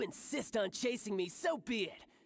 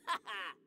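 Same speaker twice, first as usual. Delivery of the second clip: mocking laugh; 3 semitones lower than usual. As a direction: laugh off her chances. Villain mocking outrider.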